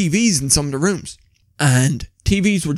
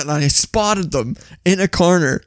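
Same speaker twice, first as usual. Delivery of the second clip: usual speaking voice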